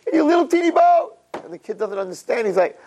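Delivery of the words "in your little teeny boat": high, sneering taunt; mocking brothers. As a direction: jeer at him from the big boat. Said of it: "his brothers are laughing".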